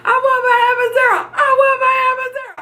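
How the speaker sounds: high, fast pleading yell; frantic mock cry for drugs; retold yelling. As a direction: beg for the epidural at full pitch. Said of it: "I kept yelling for the epidural".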